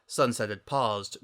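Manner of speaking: plain reading voice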